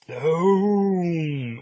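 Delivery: big dramatic explosion sound; loud boom. Retold or imitated. Reading the page-turn explosion, loud boom. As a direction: blast it out like a comic sound effect.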